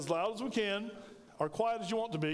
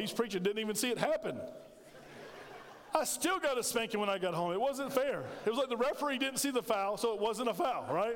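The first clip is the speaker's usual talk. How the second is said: normal talking voice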